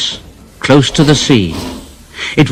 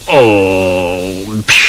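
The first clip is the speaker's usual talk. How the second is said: lower, softer huffing grumble; sulky Gordon; 5 semitones lower, 3 dB quieter than usual. Acted. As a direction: huff like a sulking big engine. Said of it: "And Gordon said".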